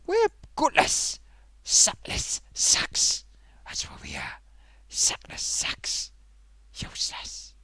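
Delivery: bitter whisper. Acted. Self-loathing whispered insults.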